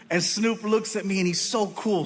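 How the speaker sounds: usual speaking voice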